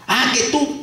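high mock shriek of someone yelling. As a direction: squeal it like someone losing their temper. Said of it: "gritar también". (grito fingido muy agudo)

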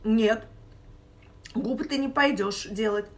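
chewing between words; eating while talking; mouth partly full. Real, not acted. Seen 0: Holds pizza slice; lips pressed, chewing motions between phrases, mouth partly full.

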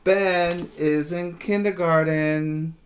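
slow, lively storybook read-aloud; reading to children. Acted. Teacher reading the story to her kindergarten class. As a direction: read it to the class like a picture book.

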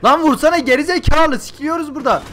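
high, loud scolding under fire; exasperated yelling. Real, not acted. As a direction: yell at a useless teammate. Berates teammate while under fire. (sinirle, tiz sesle çıkışarak)

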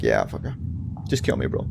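very low, creaky giving up; deadpan low mumble of defeat; mock resigned surrender. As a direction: drop way down, as if accepting death. Imagined giving up to the killer.

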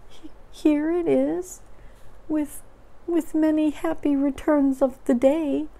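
high, halting little voice; Piglet, sniffling. A narrator's reading. Piglet gives Eeyore the burst balloon, high and faltering. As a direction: falter through it in a small, sorry voice. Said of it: "sniffling a little".

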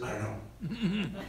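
slow, soft answer through a laugh; laughing shrug. Acted. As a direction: chuckle it out, slowly and softly. Mock answer to prepper questions.

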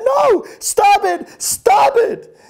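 high, slow shout pushing them away; protesting shout. Retold or imitated. The girl shouts at the men pushing her head. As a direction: cry out to make them stop. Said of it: "It was the girl who was shouting".

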